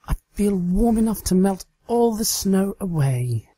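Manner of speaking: put-on high, excited young mare voice; Trixie, dreamy. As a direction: play Trixie, flushed and eager. Trixie's voice in the story.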